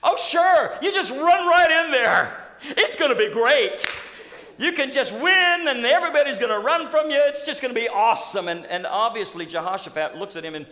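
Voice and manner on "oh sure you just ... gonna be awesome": higher, louder sarcastic laughing voice; mocking. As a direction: sneer it with fake enthusiasm. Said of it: "he just mocks him".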